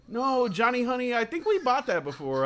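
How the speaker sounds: mock mom soothing her kid, Johnny; patient parent voice. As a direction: gently talk the kid out of it like a parent. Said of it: "and then there's the adult like".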